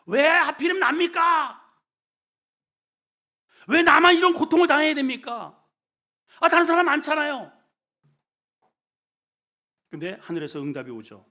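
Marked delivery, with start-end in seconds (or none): 0.1-7.5 higher, louder anguished protest; shouted complaint. Acted. Sufferer shouting his complaint at heaven. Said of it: "외치는 거예요".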